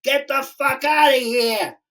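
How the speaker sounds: gangster threat. Retold or imitated. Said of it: "And he said".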